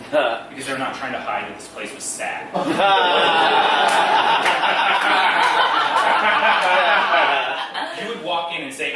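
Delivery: ordinary talk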